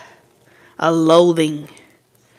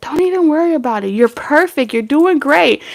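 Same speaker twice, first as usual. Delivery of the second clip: higher, quick, forced-sweet encouragement; fake cheerful praise; 8 semitones higher than usual. Higher fake-sweet praise to a partner. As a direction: gush praise you don't mean. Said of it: "supposed to be like".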